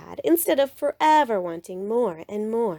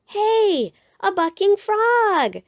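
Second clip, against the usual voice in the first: high, loud thrilled cub call; excited shout; 12 semitones higher, 5 dB louder, slower than usual. A narrator's reading. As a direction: call out the find with glee. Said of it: "shouted Brother".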